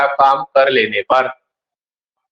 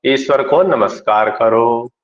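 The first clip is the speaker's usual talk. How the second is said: normal talking voice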